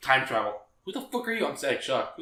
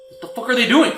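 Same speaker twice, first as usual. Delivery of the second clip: higher, louder incredulous question; exasperated reaction; 8 semitones higher, 6 dB louder than usual. Acted. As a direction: raise pitch and volume in exasperated disbelief. Voicing exasperated reaction to film.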